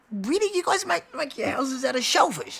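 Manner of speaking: high-pitched, astonished disbelief; incredulous question. Retold or imitated. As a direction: ask it in high amazement. Reacting in surprise to cat houses.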